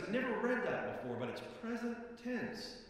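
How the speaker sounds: excited colleague. Retold or imitated. Pastor imitating Tim's excitement about the verse, eager. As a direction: retell how Tim burst in with his discovery. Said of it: "he was so excited about it".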